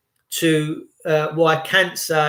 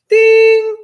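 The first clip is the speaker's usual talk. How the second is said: high loud imitated ding; ping it out like a microwave bell; mock microwave chime; 9 dB louder than usual.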